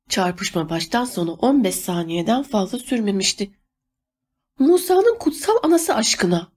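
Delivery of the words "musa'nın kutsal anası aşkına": high-pitched, mildly shouted exclamation; exclaimed cry. A narrator's reading. Adelaide exclaims at the crash from her doorway, crying out high. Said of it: "diye bağırdığını duydum".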